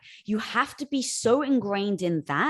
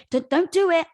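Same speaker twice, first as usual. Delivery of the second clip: higher, louder urgent plea; pleading ego voice; 8 semitones higher, 8 dB louder than usual. Retold or imitated. The protective ego warning her not to jump, higher and louder. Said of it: "trying to protect you to say".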